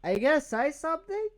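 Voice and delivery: squeaky high taunt; shrill teasing jab; mock high voice. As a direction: pitch way up and needle him. Mocking her silent friend.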